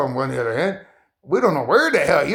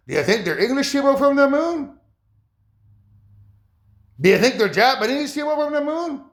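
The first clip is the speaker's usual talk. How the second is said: higher, mocking list of who else it could be; incredulous questions; 6 semitones higher than usual. Real, not acted. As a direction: push each question up in disbelief. Mocking escalating rhetorical questions.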